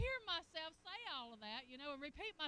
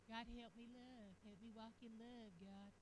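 soft, lower pleading prayer; mock quiet prayer; 6 semitones lower, 10 dB quieter than usual. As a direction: pray it softly, half mocking.

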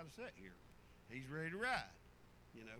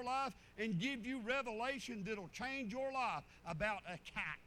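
loud, higher preaching cadence; full-force preaching; 8 semitones higher, 5 dB louder than usual. Acted. Preacher tells the church God speaks through a kite, full-force preaching. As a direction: preach it out to the congregation. Preacher drives home the point.

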